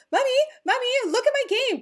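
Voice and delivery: put-on high-pitched little boy calling Mommy; imitated pestering child. Six-year-old son to his busy mom, imitated pestering child. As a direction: play a kid clamoring for attention.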